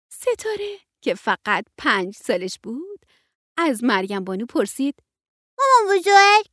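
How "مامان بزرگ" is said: put-on high, loud child's voice; little girl. Five-year-old Setareh calling her grandma, high child voice. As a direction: call grandma like a small child.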